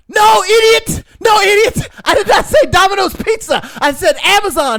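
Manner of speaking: very high, loud mock rage; furious shouting. Scammer scolding man for buying wrong cards, furious shouting. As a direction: imitate an exasperated scammer screaming.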